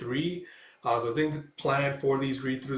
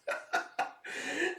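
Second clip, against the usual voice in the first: high breathless evil cackle; demon cackle; 14 semitones higher, 8 dB quieter than usual. Acted. Demon's laugh after taunt.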